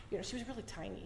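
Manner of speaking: ordinary talk, nothing marked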